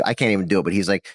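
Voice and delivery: ordinary delivery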